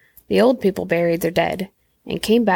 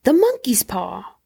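slow, higher-pitched cry; wild cry; 4 semitones higher than usual. A narrator's reading. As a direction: draw it out in wild agitation. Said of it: "she cried wildly".

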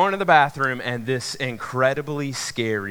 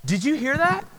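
high-pitched, alarmed girlfriend imitation; voiced girlfriend; 11 semitones higher than usual. Girlfriend interrupting the movie, high imitated alarm. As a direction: mimic her anxious question, rising sharply. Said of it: "all of a sudden she goes".

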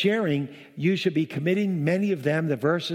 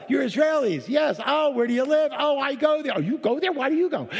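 high-pitched, eager back-and-forth; excited retold exchange; 10 semitones higher than usual. Preacher replaying a restaurant chat with Israelis, excited. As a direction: act out an overjoyed first meeting, voice way up.

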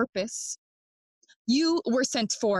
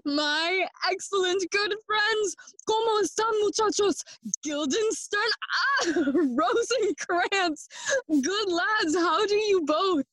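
delighted greeting; 8 semitones higher than usual. Acted. Hamlet greets old school friends.

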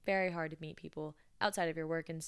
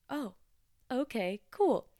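awkward cheer; 7 semitones higher, 5 dB louder, slower than usual. Retold or imitated. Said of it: "I was so embarrassed".

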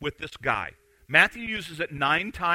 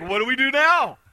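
mock crowd dismay; 6 semitones higher, 6 dB louder than usual. Followers left stranded, acted.

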